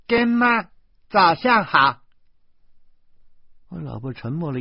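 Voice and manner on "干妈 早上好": put-on little girl's loud, high call; clear, crisp shout. A narrator's reading. Little devil greeting godmother in the living room, shouting crisply. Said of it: "小魔女在客厅里清脆地喊".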